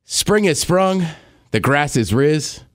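slow, solemn poem reading; poem recital. Pretend. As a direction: recite it slowly like a poet. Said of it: "This is a poem".

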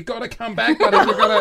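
high, laughing imitation of a desperate call; retold plea to a runaway kid; calling after. Dad chasing son down the hallway, mock-desperate calling.

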